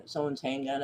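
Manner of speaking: ordinary delivery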